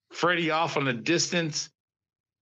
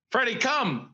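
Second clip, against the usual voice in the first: higher-pitched retold command to a dog; calling the dog; 7 semitones higher than usual. Master calling his runaway dog, retold command. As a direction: re-enact calling a dog back.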